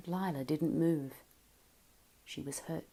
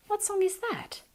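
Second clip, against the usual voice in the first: curious. Acted. Leonard asks curiously.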